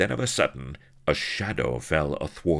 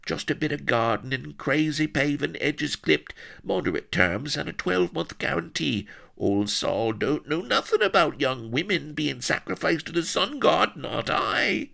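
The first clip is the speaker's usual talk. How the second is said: high-pitched rustic self-excusing patter; whining; 13 semitones higher, 3 dB louder than usual. A narrator's reading. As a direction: plead innocence in a yokel's whine. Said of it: "he whined".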